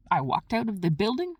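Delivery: ordinary talk, nothing marked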